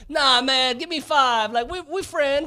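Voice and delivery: startled fan gushing in a goofy put-on voice; put-on fan accent. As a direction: play the scared fan sucking up in a silly accent. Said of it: "I don't know why I gave him that accent".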